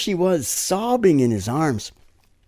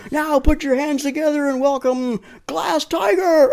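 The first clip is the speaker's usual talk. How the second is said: high, loud shouted band introduction; emcee announcement; 14 semitones higher, 4 dB louder than usual. Retold or imitated. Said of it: "So they'll say something like".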